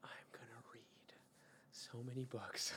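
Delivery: excited whisper, imitating a friend; hushed, barely voiced mock excitement; secretly hyped. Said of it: "like, secretly super hyped about it".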